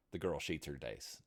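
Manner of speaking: plain reading voice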